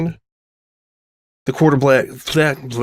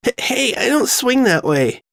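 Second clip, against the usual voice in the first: flustered high voice; 12 semitones higher than usual. Acted. Sanada pushing her away, flustered.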